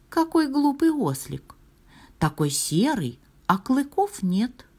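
high-pitched teasing star voice; lilting, high voice of a little star; taunting star. A narrator's reading. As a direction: tease in a high storybook voice.

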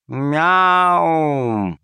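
a human meowing like a cat. A narrator's reading. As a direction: answer with a flat meow. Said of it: "ответил Калимер".

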